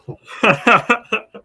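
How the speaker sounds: loud high burst of laughter; excited laugh. Real, not acted. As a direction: laugh it off, pumped. Laugh after hype.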